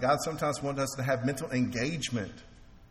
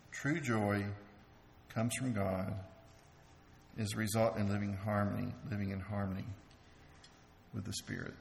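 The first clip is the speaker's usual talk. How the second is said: a hushed, lower echo of the earlier line; soft, low repeat; 5 semitones lower, 7 dB quieter than usual. Shown as a demonstration. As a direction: say it again lower and softer, letting it sink in. Repeats the same point more softly.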